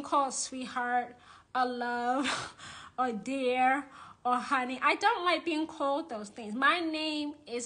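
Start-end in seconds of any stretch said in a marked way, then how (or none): none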